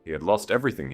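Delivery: ordinary delivery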